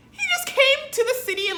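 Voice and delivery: loud, very high incredulous yell; outraged rant. Acted. Ranting that he just came unannounced, outraged yell. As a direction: cry out in comic outrage.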